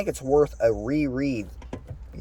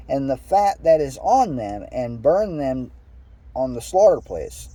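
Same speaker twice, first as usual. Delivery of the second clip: higher, measured read-aloud voice; scripture reading; 5 semitones higher than usual. Acted. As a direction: read the verse out with lilting emphasis. Reads scripture aloud to listeners.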